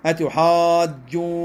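أتحاجوني slowly, with the long vowels drawn out; stretched madd. Shown as a demonstration. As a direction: draw out the alif and the waw in أتحاجوني. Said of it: "أيضًا تقول، تقول".

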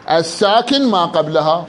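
usual speaking voice

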